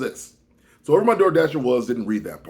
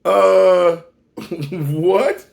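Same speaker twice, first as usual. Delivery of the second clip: stunned; 5 semitones higher, 6 dB louder than usual. Retold or imitated. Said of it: "I go".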